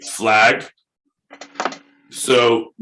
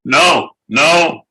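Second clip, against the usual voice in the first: loud, high, unbroken run of no's; insistent shouted no's; 7 semitones higher, 6 dB louder than usual. Real, not acted. Host cutting off the co-host, insistent shouting. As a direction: drown him out with a stream of no. Shutting down co-host's interruption.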